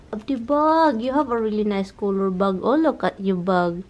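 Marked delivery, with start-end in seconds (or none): none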